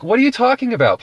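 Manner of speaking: high, baffled outburst; incredulous Pilate. Retold or imitated. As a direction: squeak out the disbelief. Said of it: "Pilate even did that".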